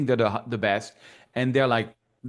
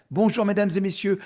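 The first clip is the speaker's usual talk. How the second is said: higher-pitched French show greeting; little French accent; 6 semitones higher than usual. Shown as a demonstration. Comic photography teacher greeting viewers, little French accent. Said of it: "little French accent".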